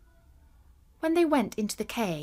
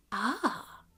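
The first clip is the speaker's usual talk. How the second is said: awed ah; 8 dB quieter than usual. A narrator's reading. People marvel.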